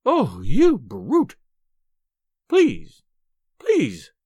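loud, drawn-out outrage turning to pleas; acted exasperated begging; exasperated pleading. A narrator's reading. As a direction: give in and beg, still indignant. Wife gives in and begs.

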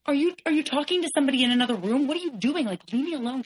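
high-pitched, rushed disbelief; acted-out incredulous questioning, pitched well up; incredulous retort. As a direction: retell it as a high, exasperated challenge. Retold incredulous retort during the fight.

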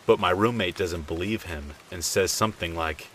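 plain reading voice

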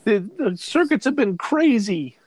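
high, fretful mom scolding her lying son; scolding mother. Acted. Mother scolds son who was missing for days, high fretful voice. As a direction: nag him like a worried mother catching a lie.

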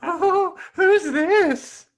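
very high, loud falsetto; high put-on girlish voice; maid of honor Sarah. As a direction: squeal it as a giddy roommate. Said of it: "Sarah, she's my roommate".

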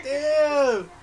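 half-laughing wounded damn; laughing mock hurt. Hurt reaction, retold.

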